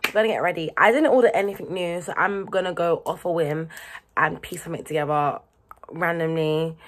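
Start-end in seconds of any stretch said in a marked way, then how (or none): none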